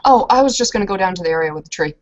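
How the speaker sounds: ordinary talk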